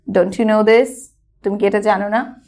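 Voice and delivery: normal talking voice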